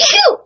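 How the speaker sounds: loud genuine sneeze; real sneeze. Real, not acted. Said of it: "that sounded like a real sneeze".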